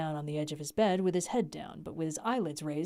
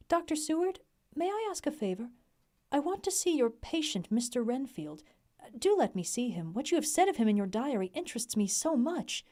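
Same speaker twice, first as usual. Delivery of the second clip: woman's voice; 6 semitones higher than usual. Acted. Mrs Harker voiced in story.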